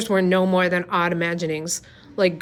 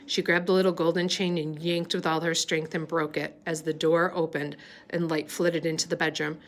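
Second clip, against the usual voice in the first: plain reading voice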